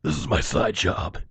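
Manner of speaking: very low, gravelly superhero voice, slow; deep gravel superhero rasp, slow; Batman. Pretend. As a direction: growl it like the caped crusader.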